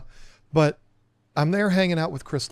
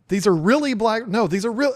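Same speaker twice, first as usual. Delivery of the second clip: higher-pitched mock bewilderment; retold confusion; 7 semitones higher, 4 dB louder than usual. Newbie caught in a Who's on First skit, confused. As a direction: replay a muddled back-and-forth.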